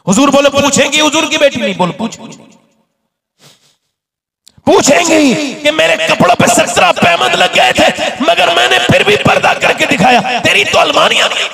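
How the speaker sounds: preacher voicing the Prophet's daughter on Judgment Day; preaching at full force. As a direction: hammer home the warning.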